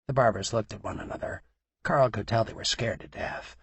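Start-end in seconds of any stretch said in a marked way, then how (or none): none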